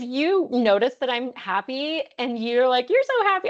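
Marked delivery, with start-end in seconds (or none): 2.9-3.5 delighted noticing. Acted. Acted friend noticing her happiness.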